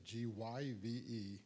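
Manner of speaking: "G Y V E" said letter by letter, slowly; spelling it out. Shown as a demonstration. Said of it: "I just spelled it".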